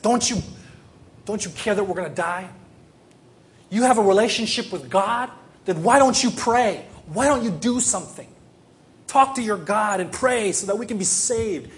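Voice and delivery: loud, high-pitched angry demands. Acted. Frightened sailors rebuking Jonah in the storm, angry. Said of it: "the people were angry with Jonah".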